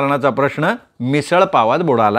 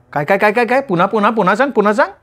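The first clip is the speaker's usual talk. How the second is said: higher, excited mock disbelief; surprised; 6 semitones higher, faster than usual. A narrator's reading. Nima hearing Paresh's proposal, playfully stunned and excited. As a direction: pretend you didn't hear, demand it again.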